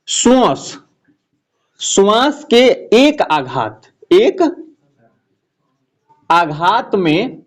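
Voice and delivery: dictated slowly, word by word with pauses; slow dictation. Shown as a demonstration. Teacher dictating the definition slowly to students. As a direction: spell the definition out slowly for notes. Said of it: "ये line आपको ध्यान रखना है".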